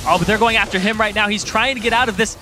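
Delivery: high, loud caster call, stretching the tense moments; tense excited play-by-play. Real, not acted. Co-caster following a player trying to escape, tense excited play-by-play. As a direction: build suspense over a narrow escape. Second caster calls a chase.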